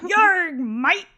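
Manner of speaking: loud hammed-up pirate with a laugh; mock pirate. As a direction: play a silly pirate, laughing. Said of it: "Is this a pirate?".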